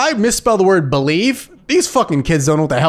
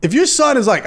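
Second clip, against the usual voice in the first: normal talking voice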